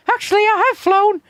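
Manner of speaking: put-on high, slow old lady voice; old woman voice. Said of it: "and she goes".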